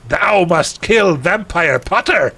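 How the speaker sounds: high, slow, hammed-up villain command; put-on archaic villain, drawn out; Voldemort. Villain ordering the heroine to kill, hammed-up command. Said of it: "that's what he sounds like".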